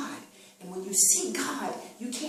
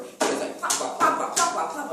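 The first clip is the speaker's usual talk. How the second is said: breathy plopping noises, higher and louder; plopping sound; 8 semitones higher, 4 dB louder than usual. Retold or imitated. Preacher mimics the dying rat, breathy plops. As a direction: mimic a half-dead rat flopping about. Imitating rat flopping on road.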